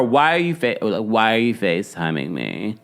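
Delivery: mock teen vocal fry, trailing low; imitating a bored son in creaky fry; lightly imitated fry voice. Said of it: "The vocal fry".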